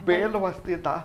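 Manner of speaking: low, soft grumble to himself; grumbling. Acted. Husband repeats wife's order to open door, grumbling quietly. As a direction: echo the order under your breath, resentfully.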